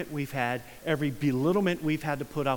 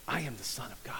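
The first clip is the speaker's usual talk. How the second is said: whispered Jesus; 6 semitones lower, 10 dB quieter, faster than usual. Pretend. Preacher voicing Jesus to the healed man, whispered. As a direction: breathe the words like a secret.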